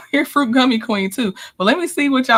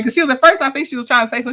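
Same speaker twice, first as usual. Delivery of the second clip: ordinary talk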